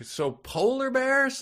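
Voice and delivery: shouting over music. Retold or imitated.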